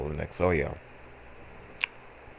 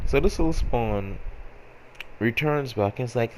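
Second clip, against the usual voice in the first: ordinary talk, nothing marked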